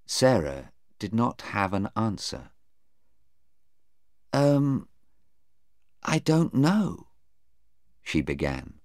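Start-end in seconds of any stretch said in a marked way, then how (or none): 4.3-7.1 higher-pitched, unsure witness voice; hesitant young witness. Acted. Sarah unable to answer the lawyer, hesitant.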